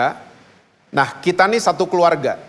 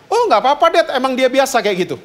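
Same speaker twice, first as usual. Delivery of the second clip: put-on higher, breezy, unbothered girl; carefree daughter; 7 semitones higher, faster than usual. Daughter telling her dad the teasing doesn't hurt, lightly higher and breezy. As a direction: shrug it off lightly.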